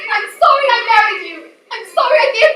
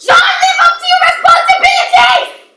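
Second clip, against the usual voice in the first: high, loud scolding yell; scolding shout; 9 semitones higher, 6 dB louder than usual. Acted. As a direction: bellow it at the husband. Wife scolds husband loudly.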